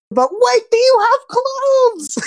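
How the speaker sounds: very high, slow squeak of alarm; squeaky alarmed cry; Caleb jumps up. Acted. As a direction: stretch the question up high in panic. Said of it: "Caleb jumps up".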